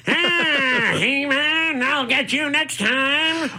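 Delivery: high, slow, nasal-free cartoon villain cackle voice; cartoon Skeletor voice. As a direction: ham up a high Saturday-morning villain. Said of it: "Skeletor's more like".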